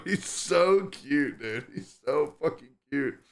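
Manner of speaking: ordinary talk, nothing marked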